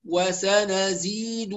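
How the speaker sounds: slow "وسنزيد" with every short vowel held its full length; full vowels, unrushed. Shown as a demonstration. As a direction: recite "وسنزيد" slowly, syllable by syllable. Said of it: "لا نختلس". (تلاوة "وسنزيد" بتمهّل وإتمام الحركات)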